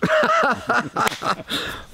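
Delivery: high amused laugh; light laugh. Real, not acted. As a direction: laugh at your own joke. Laughs after punchline.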